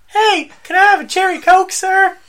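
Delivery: high-pitched mock customer request; squeaky send-up of a friend ordering; imitating the co-host. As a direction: put on a high voice and ask politely. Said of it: "I'm gonna be you".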